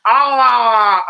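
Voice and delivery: short high strangled yell, mouth barely moving; pained yell through a broken jaw. Retold or imitated. Bouncer imitates the injured guy's yell, strangled and high. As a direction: cry out like your jaw is broken. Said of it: "He's like".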